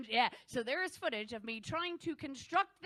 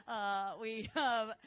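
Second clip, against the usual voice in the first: usual speaking voice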